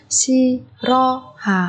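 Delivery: slow, spaced Shirohata; syllable by syllable. Shown as a demonstration. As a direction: break Shirohata into four beats. Teacher splits word by syllable. (đọc chậm Shirohata từng âm tiết)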